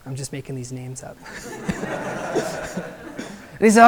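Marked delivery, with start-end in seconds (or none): 1.3-3.3 airy, high laugh at own story; breathless laugh. Real, not acted. Preacher laughs at his own made-up names, airy laugh. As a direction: let a soft airless laugh out.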